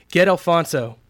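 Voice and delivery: loud, high, urgent command; insistent shout, falling at the end; forceful order. A narrator's reading. Repeats the order, pointing at a worker.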